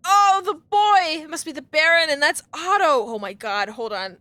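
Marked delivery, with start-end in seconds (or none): 0.1-1.2 high, loud, slow lament; grieving cry. Real, not acted. Gamer grieves the boy in the mural, mourning cry.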